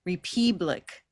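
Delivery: "republic" with stressed middle vowel as long "ee"; green-vowel mispronunciation. Shown as a demonstration. Teacher trying a wrong color on the word, "republic" as re-PEE-blik. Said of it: "If it were green, it would be".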